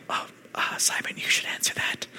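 hushed whisper aside to a friend. Pretend. Stunned disciple whispering to nudge Simon to answer. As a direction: whisper it, passing the buck. Awkward disciples pass the question.

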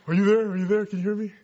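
calling out. Retold or imitated. Dad checking a bad video call with his son, calling out. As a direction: call out like a dad unsure the video link works.

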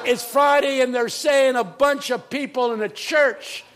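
high, loud pulpit cry; full-force preaching. Retold or imitated. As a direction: launch back into the imitated pastor's sermon. Resumes the pastor's shouted sermon.

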